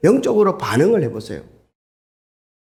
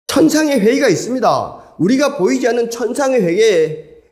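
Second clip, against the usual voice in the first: preaching at full force; 7 semitones higher than usual. Acted. Preacher warning the congregation about Satan's accusations, fervent. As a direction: drive the point home like a revival preacher.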